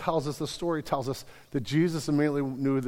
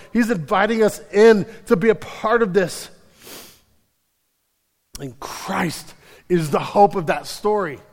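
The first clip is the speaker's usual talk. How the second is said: impassioned preaching; 5 semitones higher, 8 dB louder than usual. Acted. The pastor preaching the story to the congregation with fervor. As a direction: preach it with rising urgency.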